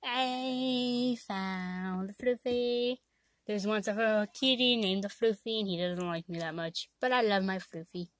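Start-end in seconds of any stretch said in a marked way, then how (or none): 0.0-3.0 slow sing-song announcement. Real, not acted. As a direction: drag it out slowly.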